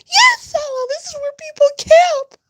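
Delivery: very high, loud squealing mock delight; mocking the wife's squeaky joy at a dirt date; mocking her voice. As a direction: shriek it like a fake thrilled date. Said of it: "shit, her voice".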